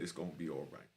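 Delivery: low, soft, gentle comfort; hushed, like consoling a broken man; soft reassurance. Retold or imitated. Cellmate hugging him in the cell, low soft reassurance.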